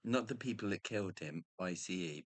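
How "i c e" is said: ordinary talk, nothing marked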